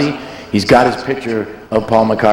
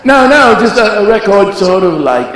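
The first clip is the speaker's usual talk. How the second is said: higher, insistent mock [name]; [name] impression; 8 semitones higher, 3 dB louder than usual. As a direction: correct him quickly as [name]. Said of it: "He went".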